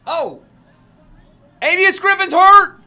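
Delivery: stretched excited yell; excited shout. Real, not acted. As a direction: shout it out, hyped. Streamer yelling at fight.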